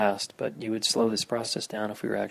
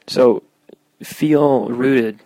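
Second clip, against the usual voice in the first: guided meditation; 5 dB louder than usual. Acted. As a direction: guide listeners through grounding. Leads a meditation.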